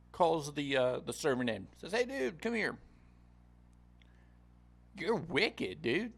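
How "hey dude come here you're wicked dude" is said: a much higher, casual king calling him out; casual angry king. Retold or imitated. King summons the servant who wouldn't forgive, high and scolding. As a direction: make him a laid-back king who is fed up, dude.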